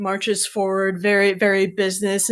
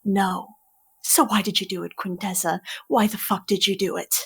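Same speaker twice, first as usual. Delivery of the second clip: Curling, scornful mentor. Acted. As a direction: drag out the contempt, mocking every word. GM voices Curling mocking her excuses.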